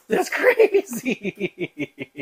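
high, hugely drawn-out mock amazement; astonished. Retelling the rewind of the show, exaggerated astonishment. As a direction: stretch it out in exaggerated disbelief. Retold astonishment at the accent change.

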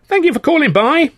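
high, loud goodbye to a visitor; hearty farewell. Acted. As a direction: send the guest off loudly. Beckett calls a farewell to visitor.

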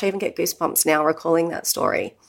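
ordinary delivery

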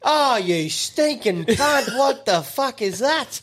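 high, slow drawn-out disgust; disgusted mock outcry. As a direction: moan about the smell, hammed-up. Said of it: "we're going".

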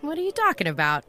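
jumps up high, playing dumb; mock disbelief. To the bartender, dodging her question, mock-incredulous and pitched high. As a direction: brush off the question with fake surprise.

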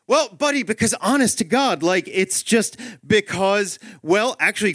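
high-pitched, grasping for words; floundering dad. Acted. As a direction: fumble toward an answer, voice pushed up.

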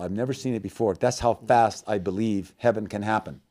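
ordinary delivery

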